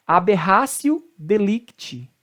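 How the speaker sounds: slow aberratio with an S sound, "aberrásio"; Latin T as C. Shown as a demonstration. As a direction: aberratio ending in "-sio". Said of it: "o T tem som de C".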